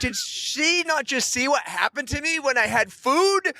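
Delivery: high-pitched panicked thoughts about being handed food; panicked inner voice. Retold or imitated. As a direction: squeal it like he's mortified.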